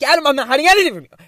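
high, loud, fast protest; getting frustrated. Retold or imitated. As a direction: reenact an exasperated outburst in a rush. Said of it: "getting frustrated 'cause I'm like".

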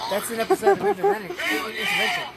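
cracking up while shouting; excited laughing shout. Real, not acted.

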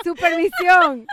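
loud, high, drawn-out "Supervisión"; emphatic correction. Real, not acted. Friend accepts the correction, loudly emphatic "Supervisión". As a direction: proclaim the right word, "Supervisión". Repeats the correct word laughing.

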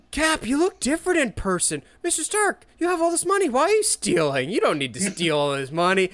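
put-on high, eager kid voice; earnest teen. Fan voicing Peter meeting fake Avengers, high and eager. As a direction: talk to heroes like a starstruck kid.

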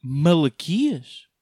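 very slow, stretched out; slow solemn drawl. Acted. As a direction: intone it like a prophet. Prophet name punchline. (nome entoado muito devagar)

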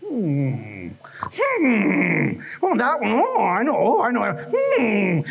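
long humming, knowing oohs; mock fault-spotting; nosy fault-finder. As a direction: imitate a snoop inspecting someone, with drawn-out hmms. Voices fault-finders peering through telescope.

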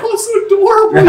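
very high mock coo with laughter; hammed-up adoring squeal; imitated cooing dancers. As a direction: gush it high, mimicking the girls.

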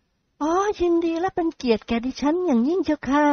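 put-on high, soft, polite girl voice; sweet polite young lady; young woman voice. Young model speaks politely. (เสียงหญิงสาวสุภาพอ่อนหวาน)

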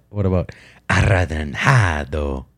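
"arradanjado" slow with heavily rolled R's; rolled R demo. Shown as a demonstration. Testing her friend on rolled R's, slow trilled demo. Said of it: "it is more rolling in some".

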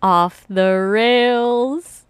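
stretched out very slowly; slow drawl. Real, not acted. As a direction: draw each word out. Drawn-out comment.

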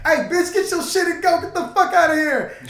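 high, loud imitation of ordering someone out; mock angry yelling. Imitating the homies throwing the girl out, mock yell. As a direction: act out the yell with amusement. Acting friends kicking girl out.

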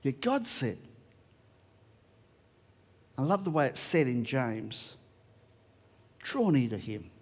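ordinary delivery

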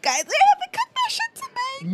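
put-on drunk blurting voice. Mimics drunk self at wedding.

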